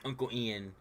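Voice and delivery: ordinary talk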